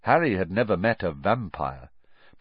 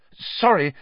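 hasty apology, pitched up; 9 semitones higher, 6 dB louder than usual. A narrator's reading. Harry apologising to Stan, hastily. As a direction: blurt out a quick apology. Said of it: "said Harry hastily".

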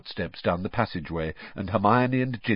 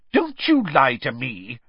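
scolding; 4 semitones higher, 5 dB louder than usual. Acted. Mother scolding son.

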